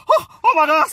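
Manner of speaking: high, loud gasped exclamation; shocked realization. Acted. Adam and Eve find themselves naked, shocked. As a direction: cry out in sudden shock. They discover they are naked.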